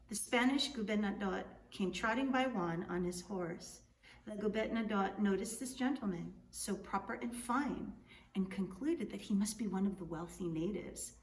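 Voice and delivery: usual speaking voice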